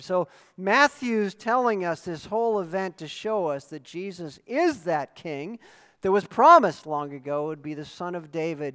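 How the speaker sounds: ordinary delivery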